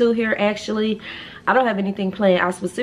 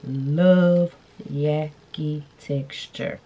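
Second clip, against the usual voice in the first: slow, stretched love Yaki Texture; drawn out; 3 semitones lower, much slower than usual. Real, not acted. Telling brands what she loves, slow and stretched. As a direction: linger on every word of it. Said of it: "drawn out love".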